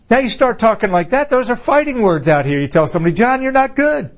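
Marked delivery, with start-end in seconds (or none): 3.1-4.1 high-pitched blunt put-down; mock confrontation. Preacher demonstrating fighting words on someone, mock confrontation. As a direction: pretend to pick a fight. Acts telling somebody a blunt truth.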